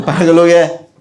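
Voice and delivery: ordinary delivery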